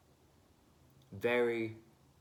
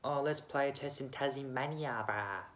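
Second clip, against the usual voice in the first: higher put-on eshay voice; mocking eshay bro; 6 semitones higher than usual. Mocking cricket bosses moving the test, eshay bro voice. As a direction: mimic a bro's silly suggestion. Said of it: "They just Eshe'd off to".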